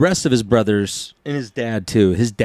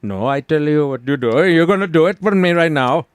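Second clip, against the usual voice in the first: higher, insistent imitated doctor with a foreign accent; Doctor Rashans; 6 semitones higher, 4 dB louder than usual. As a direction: order them about as an accented doctor. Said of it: "And he's like".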